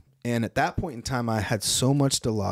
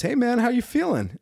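breezy doctor; 12 semitones higher than usual. Retold or imitated. Voicing the cheerful doctor.

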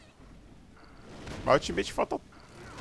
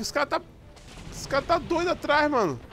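high panicked screaming; being chased. Real, not acted. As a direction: yell for your life, fleeing. Chased by angry players. (gritando em pânico, fugindo)

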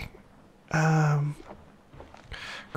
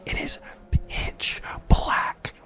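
hushed game whisper; leaning in to whisper. Real, not acted. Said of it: "get my whisper voice on".

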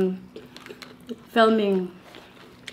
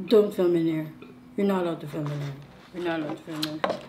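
stern staff voice; 6 semitones lower, 5 dB quieter, faster than usual. Retold or imitated. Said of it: "In that voice".

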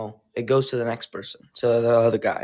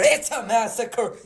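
higher, hyped call of massacre; excited shout; 8 semitones higher than usual. Real, not acted. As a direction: announce the carnage with glee.

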